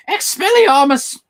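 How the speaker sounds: high, drawn-out spell cry; shouted spell. Pretend. Streamer playfully casts a Harry Potter spell, high shout. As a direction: cast it loud and high, stretching it.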